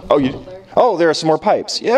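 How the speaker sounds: ordinary delivery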